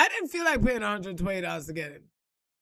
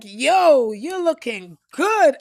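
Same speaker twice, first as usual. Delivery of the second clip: loud hype; 3 semitones higher, 10 dB louder, much slower than usual. Retold or imitated. Man hypes her up.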